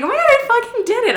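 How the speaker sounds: excited triumph. Retold or imitated. Daughter after serving her father, excitedly triumphant. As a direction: squeal it quickly in retold disbelief.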